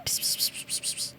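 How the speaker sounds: unvoiced psst psst to lure a cat; whispered psst calls. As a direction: hiss softly like calling a kitten. Calling cats.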